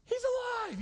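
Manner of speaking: he's alive, shrieked high; amazed high-pitched cry; excited high shout. Retold or imitated. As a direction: burst out in amazed joy. Shocked fish twitches.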